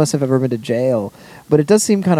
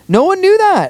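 much higher and louder protest; emphatic outburst; 6 dB louder than usual. Real, not acted. Insisting to co-hosts that bail refunds are unknown, emphatic outburst. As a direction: burst out, voice jumping way up. Exclaiming in exasperation over bail.

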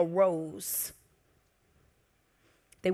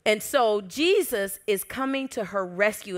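usual speaking voice

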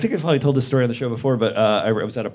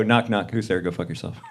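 mock knock-knock joke; 6 semitones lower, 4 dB quieter than usual. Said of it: "you ever just wanna go".

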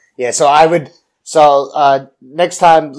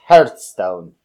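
mock Scottish accent; 6 semitones lower, 7 dB louder than usual. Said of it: "It's Scottish".

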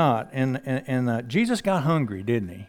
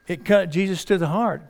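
normal talking voice

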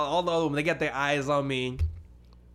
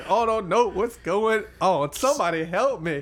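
mock panic; 4 semitones higher than usual. Man cornered by a forward woman, mock panicked plea. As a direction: plead for help, playing up the distress.

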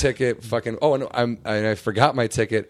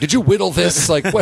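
high, loud imitation of a baffled guard; disbelieving outburst, played for laughs; mock incredulous; 8 semitones higher, 8 dB louder than usual. Guard puzzled by a wood box, loud mock disbelief.

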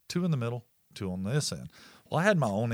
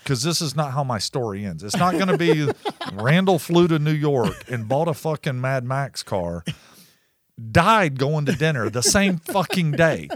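louder, half-joking rant at the driver; mock rant; 3 semitones higher, 7 dB louder than usual. Ranting at reckless driver.

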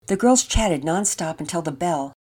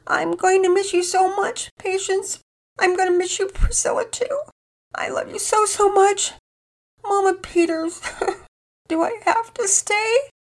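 put-on high, tearful child sobbing goodbyes; a little girl breaking down at parting; crying inconsolably; 4 dB louder than usual. A narrator's reading. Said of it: "She began to cry inconsolably".

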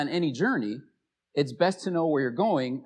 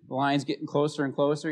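ordinary delivery

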